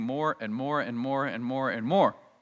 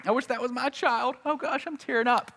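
mock sentimental parent; 9 semitones higher than usual. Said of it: "we're like".

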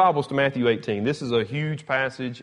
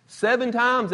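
high, louder questioning burst; incredulous question; 11 semitones higher, 3 dB louder than usual. Acted. Peter questioning Jesus on how often to forgive, incredulous. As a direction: ask it high, as if that is plenty.

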